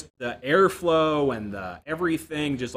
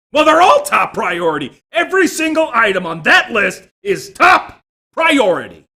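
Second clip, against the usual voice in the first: much louder, higher, slow shout of TOP priority; mock shouting boss; 10 semitones higher, 15 dB louder than usual. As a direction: bellow it like an exasperated boss. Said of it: "he looked at me and says".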